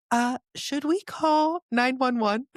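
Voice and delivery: high, slow, tentative question; unsure bystander asking very slowly, high-voiced; hesitant worried friend. Voices worried friend watching her.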